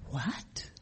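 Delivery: breathless disbelieving whisper; barely voiced, as if the words can't be true; stunned whisper. Reacting to sponsor's advice.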